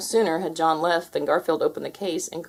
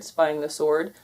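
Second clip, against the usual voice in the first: ordinary delivery, nothing marked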